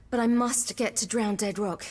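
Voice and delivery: normal talking voice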